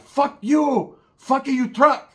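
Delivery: high, loud slow yelling; mock shouting. The paisas shouting at Roach, high and loud. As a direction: yell it across the lot.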